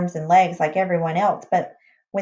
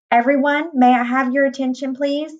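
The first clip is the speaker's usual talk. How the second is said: raised, higher-pitched public announcement; addressing a crowd; 6 semitones higher, 3 dB louder than usual. A narrator's reading. Master of the house to guests, calling attention. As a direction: call the room to attention.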